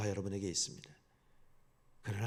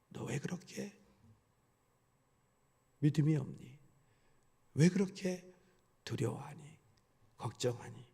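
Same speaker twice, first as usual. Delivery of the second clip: gentle questioning; 2 semitones higher, 4 dB quieter than usual. Pretend. Jesus gently questioning believers.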